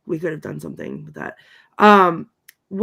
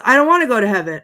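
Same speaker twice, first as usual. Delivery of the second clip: louder, higher mock protest; imitated indignant astonishment; 4 semitones higher, 8 dB louder, faster than usual. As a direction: balk loudly, imitating someone astounded. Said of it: "astounded that he wants her".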